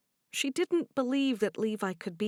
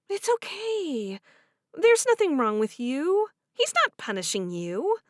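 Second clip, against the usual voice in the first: higher, warm voiced heroine; 7 semitones higher, 4 dB louder than usual. Cherish offering to do the dishes, higher and bright. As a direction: speak up brightly to her friend.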